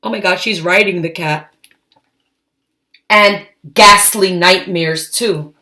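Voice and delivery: ordinary delivery, nothing marked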